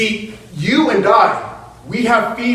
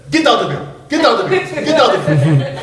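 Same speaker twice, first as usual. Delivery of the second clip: lightly voiced grandma shooing pests away; mock scolding, repeated in quick bursts; grandmother shooing; 3 semitones higher than usual. Grandma shoos bullfrogs away with her shoe, mock scolding. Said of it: "she walked towards those bullfrogs and she said".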